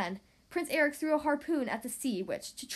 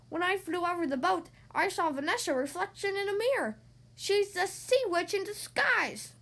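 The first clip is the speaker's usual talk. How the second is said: seagull voice; 5 semitones higher than usual. Acted. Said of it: "he said".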